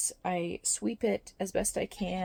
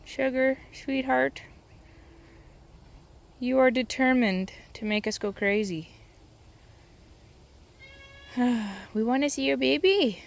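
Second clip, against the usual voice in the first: louder, higher, sing-song coaxing; coaxing pet talk; 4 semitones higher, 8 dB louder than usual. Real, not acted. A farmer sweet-talking her overdue pregnant goat, loud and high.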